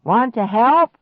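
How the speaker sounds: loud, high call, lightly voiced old man; grandpa yelling. A narrator's reading. Grandpa yelling to the kids while waving a saw. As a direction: holler the offer across. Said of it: "Grandpa shouted again".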